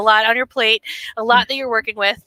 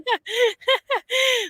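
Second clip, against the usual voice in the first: a high, falling laugh; real amused laugh; 12 semitones higher, 3 dB quieter than usual. Real, not acted. Host laughs with guest.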